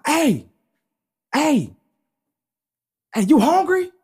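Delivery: loud, high shout across the way; calling out. Retold or imitated. Diner hails a man going through the trash, calling out loudly. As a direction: holler to get his attention.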